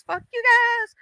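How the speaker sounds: high-pitched imitation of Christine's clip line; quoting the clip. To Christine, replaying her clip line in a high mock voice. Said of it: "that little clip that says".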